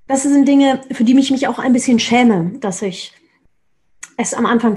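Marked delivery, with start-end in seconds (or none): none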